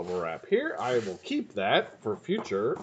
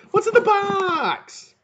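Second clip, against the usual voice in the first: very high, loud, long-drawn wail; hammed-up anguished yell; mock desperate cry; 6 dB louder, much slower than usual. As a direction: cry it out bigger, stretching BOX right out. Repeats it bigger.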